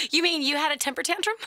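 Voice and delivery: quick, high, through laughter; amused question bubbling with real laughter; laughing tease. Real, not acted. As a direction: tease while cracking up. Host teasing caller between laughs.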